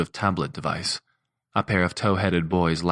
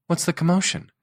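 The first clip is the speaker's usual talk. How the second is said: much higher woman's voice; new associate asks about the noise; associate Juniper; 12 semitones higher than usual. A narrator's reading. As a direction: ask it with a puzzled frown.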